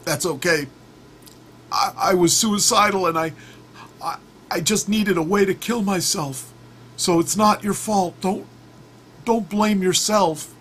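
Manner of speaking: high, strained put-on frog voice; dying frog. Stung frog absolving the scorpion, high and halting. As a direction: confess haltingly in a high cartoon voice.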